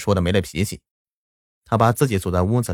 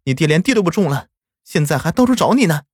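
scolding; 8 semitones higher than usual. A narrator's reading. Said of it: "娘就责怪我说道".

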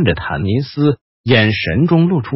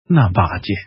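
hoarse; 5 semitones lower than usual. Acted. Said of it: "他沙哑地说着".

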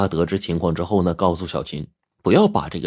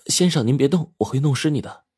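lightly voiced hairdresser, higher pitch; polite hairdresser; 7 semitones higher than usual. A narrator's reading. Xiaoqin washing the customer's hair, polite caution. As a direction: gently caution him in a slightly put-on higher voice.

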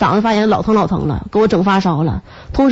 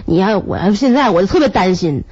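usual speaking voice

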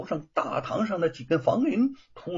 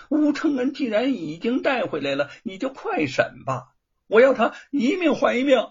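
insistent demand; 9 semitones higher, 5 dB louder than usual. Acted. Young master demands quick trial.

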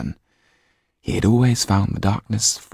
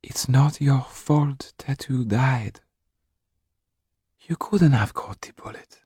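slow, whispered, much higher comfort; tender whisper; 8 semitones higher than usual. A narrator's reading. Comforting grieving boy.

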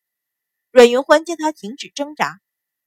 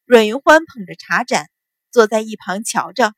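plain reading voice

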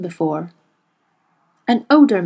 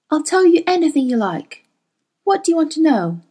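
higher, sweetly coaxing woman's voice; Mrs Coulter, coaxing; 6 semitones higher than usual. A narrator's reading. Mrs Coulter coaxing Carlo in the tent, high and sweet. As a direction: purr it up high to win him over.

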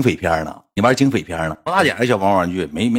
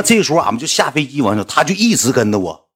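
ordinary delivery

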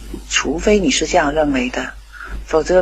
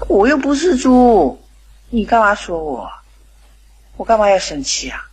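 a lightly voiced teenage son bristling; mock-offended boy insisting he isn't a pig; indignant son; 5 semitones higher than usual.

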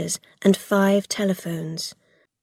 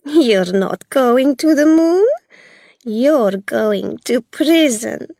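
high, loud gloating woman; taunting Belle; 6 semitones higher, 5 dB louder than usual. A narrator's reading. Belle taunting the captured children.

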